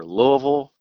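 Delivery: Louisville slurred to "LOH-uh-vul"; Louisville with a loose, swallowed middle; local Louisville variant. Shown as a demonstration. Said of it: "in this area, you say".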